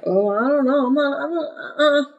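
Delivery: whimpering little reluctant voice; hesitant dog. Acted. Dog refusing the leash next day, hesitant. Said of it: "when they start giving that hesitation".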